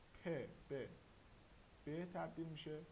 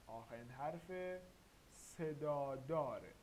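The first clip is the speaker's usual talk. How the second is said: slow repeated emphasis; slower than usual. Shown as a demonstration. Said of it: "teacher repeats the rule".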